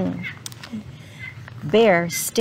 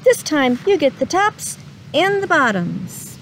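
calling out; 8 semitones higher than usual. Acted. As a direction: sing out Bear's name from afar. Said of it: "Hare called out".

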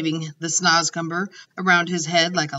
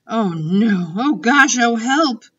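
rising, louder cries of revulsion; spluttering distress; 5 semitones higher, 6 dB louder, slower than usual. A narrator's reading. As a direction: panic at a revolting taste. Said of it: "she spluttered".

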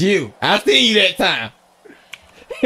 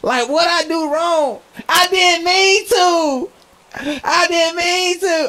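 high, slow whiny pleading; whimpering I didn't mean to; mock pleading; 11 semitones higher, slower than usual. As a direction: play the scared innocent, drawn out and high. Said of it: "pleading after startled".

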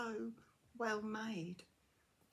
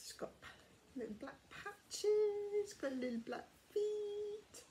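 much higher, slow cooing over tiny details; delighted cooing; 13 semitones higher than usual. Real, not acted. Admiring the crocheted cow's tiny feet, slow high cooing. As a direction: coo over the little patches and feet.

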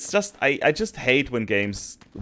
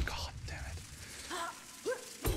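very soft, slow, voiceless mutter; whispered curse; 19 dB quieter, much slower than usual. Real, not acted. Player fails in hated area, whispered curse. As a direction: let it out under the breath.

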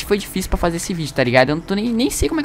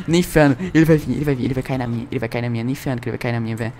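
ordinary delivery

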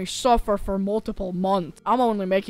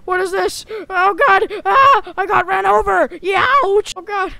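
panicked yelling; 7 semitones higher, 7 dB louder than usual. Acted. Ragdoll run over, screaming.

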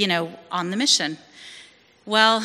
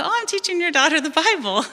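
higher, cheerful imitation of her naive younger self; bright-eyed and happy; 8 semitones higher than usual. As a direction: chirp it like an eager newcomer. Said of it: "how bright-eyed and bushy-tailed and all happy".

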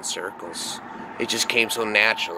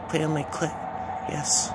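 higher, soft woman's voice; hushed, pitched-up pleading; softly pleading female voice; 7 semitones higher, 4 dB quieter than usual. Retold or imitated. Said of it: "she kept saying that over and over".

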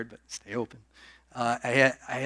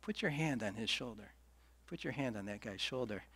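much softer, a gentle inner prompting; soft inner nudge; 12 dB quieter than usual. Retold or imitated. The Spirit nudging him toward the stranger, softly. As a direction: hush it like a quiet inner voice. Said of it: "just a little nudge".

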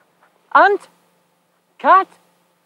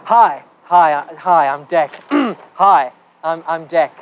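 rehearsing cool; 8 semitones lower, slower than usual. Shown as a demonstration. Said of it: "be cool".

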